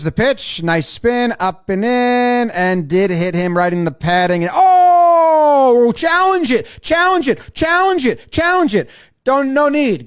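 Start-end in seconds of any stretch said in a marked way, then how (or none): 4.5-10.1 high, urgent repeated demand; long cry, then punchy insistent calls; acted frantic appeal. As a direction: plead for a replay challenge, pitched way up. Acted urgent reaction to the pitch.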